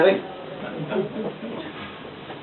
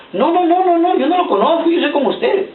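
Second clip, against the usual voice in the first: higher, hurried nervous no's; frantic denial; 8 semitones higher than usual. Retold or imitated. Peter denying Jesus, higher panicked protest. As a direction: deny it in a panic.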